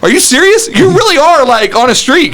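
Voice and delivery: put-on high, disbelieving teen voice; a daughter amazed at her father's streak.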